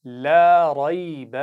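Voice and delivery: drawn-out, melodic tajweed recitation; slow Quran recitation. Shown as a demonstration. Teacher reciting slowly for pupils to repeat. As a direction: chant each syllable slowly for the class to copy. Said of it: "आप गौर से सुनिए और पढ़िए".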